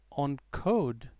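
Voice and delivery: usual speaking voice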